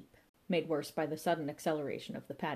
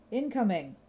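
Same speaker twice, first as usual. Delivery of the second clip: higher, louder warning shout; 4 semitones higher, 4 dB louder than usual. A narrator's reading. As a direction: yell the warning before diving.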